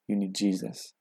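soft, earnest realization; sincere concern. Retold or imitated. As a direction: say it gently, as a quiet thought. Retold first thought.

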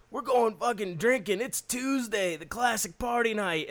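high, loud excited voice; rally the crew to go drinking; hyped party call. Retold or imitated. Supervisor rallying the guys to go drinking, high and excited. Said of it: "he's like".

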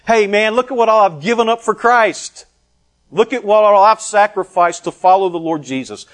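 loud, high-pitched bragging; boastful declaration. Acted. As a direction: brag to the whole crowd about your sacrifices. Acting a boastful believer to the crowd.

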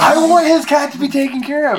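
indignant insistence. Real, not acted. Squabble over the cat's gold.

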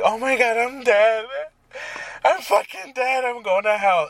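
high-pitched dramatic panicked wail; mock panic. Podcaster reenacting waking up sure they had died, mock panic. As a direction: freak out in squealed, exaggerated terror.

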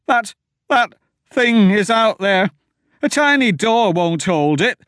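high, loud, stammering panic; terrified high pleading. A narrator's reading. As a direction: beg them to flee. Wilmer panicking at door.

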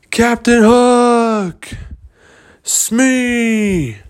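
high, long-drawn holler across the water; yelling search call. Acted. Searchers yelling for Hook and Smee, long-drawn shouts. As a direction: call out for someone lost, stretching each name. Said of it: "they were going around there yelling".

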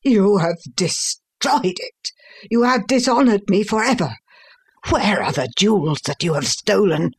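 high-pitched outraged accusation; beside himself with rage. A narrator's reading. Father accusing his son of ruining him, enraged. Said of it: "beside myself with rage".

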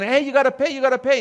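retold attendant yelling after the driver; calling out. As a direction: shout after him to pay up.

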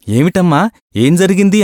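high, startled asking what happened; alarmed question. A narrator's reading. Lodger startled as the crying landlady rushes in, alarmed.

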